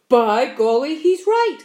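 loud, surprised exclamation; centipede's loud burst of agreement; excited cry. A narrator's reading. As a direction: cry out in sudden realisation. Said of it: "cried the centipede".

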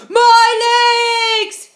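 high, loud furious scream; angry scream. Acted. Centipede screaming in anger at the paint, high and loud. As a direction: scream it out in rage. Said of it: "screamed with anger".

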